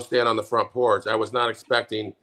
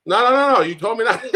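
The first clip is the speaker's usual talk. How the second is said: higher, louder no's broken by laughter; laughing protest; 9 semitones higher, 5 dB louder than usual. Real, not acted. Laughing his no's at the co-host who cut the topic.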